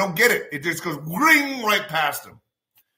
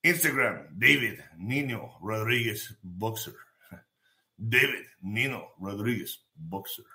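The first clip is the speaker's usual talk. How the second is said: deep goofy voice; 8 semitones lower, 7 dB quieter than usual. Pretend. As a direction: drop the voice way down for a joke. Low goofy name repeat.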